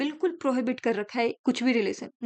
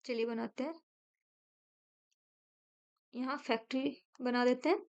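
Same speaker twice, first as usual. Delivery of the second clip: ordinary delivery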